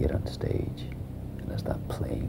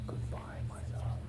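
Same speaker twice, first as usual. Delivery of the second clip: whispered goodbye; 8 dB quieter than usual. Acted. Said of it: "So I whisper".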